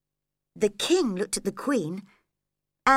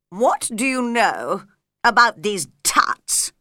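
louder stern king; king questioning; 6 dB louder than usual. Acted. King questioning Alice, loud and stern. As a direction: press her for an answer.

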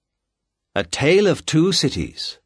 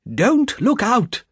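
sharp warning cry; 11 semitones higher, slower than usual. A narrator's reading. Mister Lorry warns the doctor away from the window, urgently. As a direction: bark the warning to stop him. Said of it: "cried Mister Lorry".

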